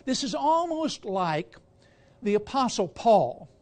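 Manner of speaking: ordinary talk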